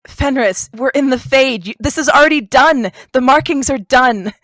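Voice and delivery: high, loud and urgent pleading; desperately trying to break through to him; party member frantically pleading with a tortured Fenris; frantic. Acted.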